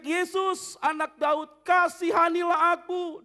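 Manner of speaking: high, loud pleading shout. Acted. Blind man shouting to Jesus, loud. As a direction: shout for mercy. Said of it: "waktu dia berteriak".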